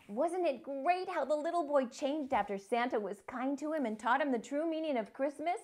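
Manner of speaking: usual speaking voice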